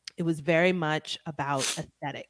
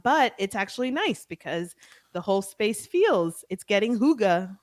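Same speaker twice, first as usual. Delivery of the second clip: normal talking voice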